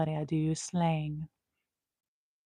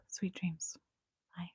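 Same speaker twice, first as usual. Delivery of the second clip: much softer unvoiced whisper; whispered sweet dreams; 13 dB quieter, slower than usual. Real, not acted. Signing off to viewers, whisper. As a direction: breathe it goodnight.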